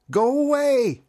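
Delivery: high, loud shout of go away. Acted. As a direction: yell it to drive the thing back. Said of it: "he shouted".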